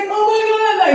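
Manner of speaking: high-pitched imitation of crying client; hammed-up bursting into tears; mock tearful wail. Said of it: "busts into tears".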